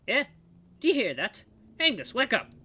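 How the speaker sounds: put-on high, urgent old lookout voice; old guard, alarmed. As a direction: rouse a dozing partner in a high old-man voice.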